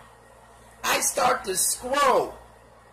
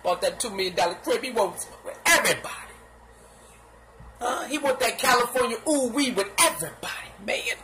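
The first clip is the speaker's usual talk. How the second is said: ordinary talk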